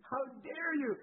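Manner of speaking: hammed-up a touch, scolding the pastor; a hint of fury at the hypocrite pastor; mock indignant. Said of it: "I just was so mad".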